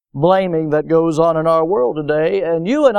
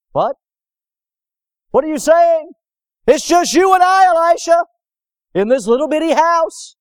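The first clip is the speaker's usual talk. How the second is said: much higher, louder alarmed cry; panicked servant; 8 semitones higher, 4 dB louder than usual. Acted. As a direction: burst in terrified to wake the prophet. Servant disbelieves Elisha's calm answer.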